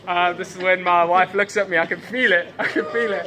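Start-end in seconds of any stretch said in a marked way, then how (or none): 1.8-3.3 tag laughing. Real, not acted. Embarrassed joke about wife's look.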